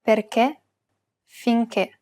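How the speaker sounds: Perché, finché with a stressed closed final E; Perché, finché, each ending on a tight closed E. Shown as a demonstration. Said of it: "pronounce it as a closed E".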